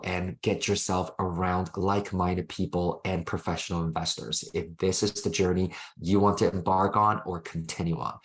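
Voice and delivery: ordinary talk, nothing marked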